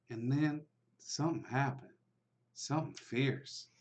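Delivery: hushed storytelling bartender, slowing for effect; bartender telling a legend. Acted. Bartender telling the stranger the town's dark legend, hushed. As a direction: lean in and spin the old town tale.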